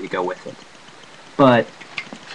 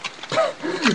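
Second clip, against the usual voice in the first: high laugh; 8 dB quieter than usual. Real, not acted. Laughs at own mistake.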